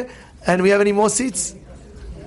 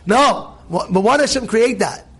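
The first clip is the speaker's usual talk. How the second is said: ordinary talk, nothing marked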